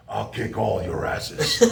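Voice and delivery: Billy Robinson. Retold or imitated. Old coach taunting a table of football players, slow menacing. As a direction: lean over the table and threaten them with a grin. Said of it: "best Billy Robinson imitation".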